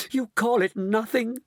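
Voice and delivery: high, indignant cry. A narrator's reading. Mrs Bucket to Mister Wonka, crying out. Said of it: "cried poor Missus Bucket".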